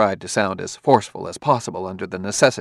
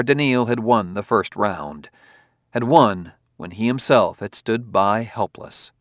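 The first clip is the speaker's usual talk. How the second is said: usual speaking voice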